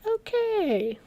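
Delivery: the dad's baffled okay to the cab driver; startled okay. Retold or imitated. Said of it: "And my dad was like".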